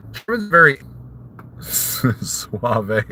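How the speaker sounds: breaking into laughter mid-sentence; amused at your own joke, laugh through it; cracking himself up. Real, not acted. Joking to viewers about German, cracking himself up. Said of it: "Oh, I'm cracking myself up".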